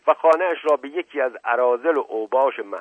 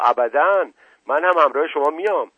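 louder, higher insistence; defiant refusal; 5 semitones higher, 6 dB louder than usual. Acted. Wife refuses the inspector's order to stay, defiant. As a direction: refuse flatly and insist on coming.

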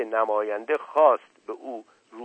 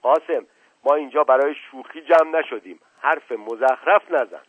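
louder, stern, slowing on the last words; angry; 3 semitones higher, 7 dB louder than usual. Acted. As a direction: scold the servant firmly.

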